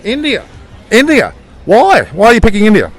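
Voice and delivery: high, bright-eyed repeat of the answer; surprised. Real, not acted. Interviewer to Siobhan, pitched high in surprise. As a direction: act amazed at her pick. Interviewer reacts to India pick.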